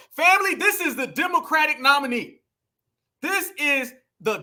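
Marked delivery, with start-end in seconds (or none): none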